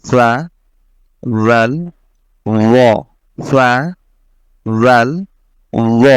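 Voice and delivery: "ফা, রাল, র" with tongue tip on upper front teeth; tongue-tip articulation. Shown as a demonstration. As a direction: say "ফা, রাল, র" as Arabic interdentals, each separately. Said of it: "তথা জিভের আগা". ("ফা, রাল, র" জিভের আগা উপরের দাঁতে লাগিয়ে উচ্চারণ)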